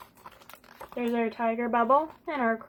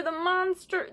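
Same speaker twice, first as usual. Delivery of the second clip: ordinary delivery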